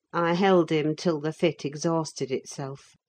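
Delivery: ordinary delivery, nothing marked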